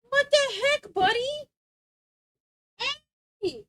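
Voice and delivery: high-pitched pet scolding; scolding the dog. Real, not acted.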